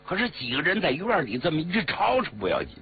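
plain reading voice

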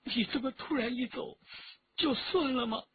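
high-pitched tearful reproach. A narrator's reading. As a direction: ask through tears, voice lifted. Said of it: "李元直哭了". (带着哭腔委屈地问)

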